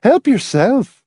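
high, slow gushing hostess. A narrator's reading. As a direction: Mrs Pritchett urges them to help themselves, gushing. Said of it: "Missus Pritchett gushed".

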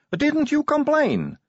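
very high, slow questioning voice; innocent recruit. Acted. New recruit questioning sergeant about pranks, high puzzled voice.

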